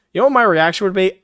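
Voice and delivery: quick eager setup; excited buildup. Real, not acted. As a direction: rev up the question for the punchline. Building up to a rant.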